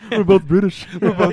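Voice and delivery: high, loud put-on British voice; mock British accent. Joking that both Nolans turned British, mock British accent. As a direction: exclaim it as a hammed-up Brit. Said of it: "you magically turned British".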